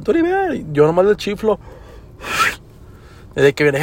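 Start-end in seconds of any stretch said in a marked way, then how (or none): 0.0-0.5 mock yelling. Imitating people who yell at him, high mock yell. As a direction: mimic a shouter way up in pitch. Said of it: "muchos me gritan".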